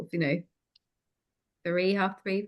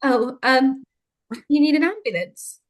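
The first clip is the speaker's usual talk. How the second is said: mock flustered operator, higher voice; flustered call handler; 6 semitones higher, 4 dB louder than usual. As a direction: play the caught-off-guard hospital worker. Mimicking flustered hospital call handler.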